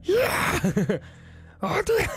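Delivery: sudden high yell, imitating a furious manager; angry boss. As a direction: shout it like a boss catching you. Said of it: "이렇게 한 거예요". (화난 매니저 흉내 고함)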